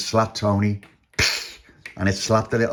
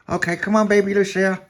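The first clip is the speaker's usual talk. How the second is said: high, raised character voice; voiced character; 11 semitones higher, 5 dB louder than usual. A narrator's reading. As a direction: call the baby along, high. Tony gives in.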